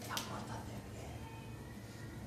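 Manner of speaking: slow unvoiced whisper. Shown as a demonstration. Preacher showing how to speak to children, whispered slowly. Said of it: "Así tenemos que hablarle a nuestros hijos".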